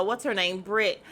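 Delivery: ordinary delivery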